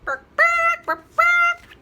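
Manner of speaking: very high, loud mock barks; barking. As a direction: yap like a tiny dog. Animal noises in story.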